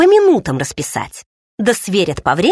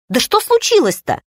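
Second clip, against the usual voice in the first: indignant; 10 semitones higher than usual. A narrator's reading. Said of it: "возмутилась я".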